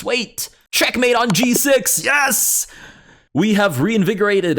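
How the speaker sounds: high, loud triumphant shout; excited shout. Real, not acted. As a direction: burst out with sudden joy. Spots checkmate, celebrates.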